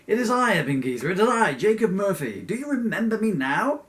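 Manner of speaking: loud, high theatrical ghost reveal; hammed-up booming announcement, pitched high; dramatic proclamation. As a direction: proclaim it at full voice to be recognised. Ghost dramatically reveals his identity.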